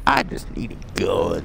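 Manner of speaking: deep mock growl of a gun nut; low mocking voice. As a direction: drop low and mock the line.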